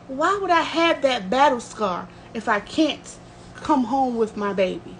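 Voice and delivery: usual speaking voice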